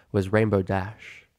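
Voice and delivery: ordinary delivery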